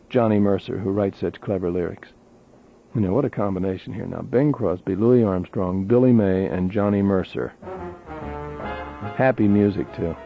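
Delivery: ordinary talk, nothing marked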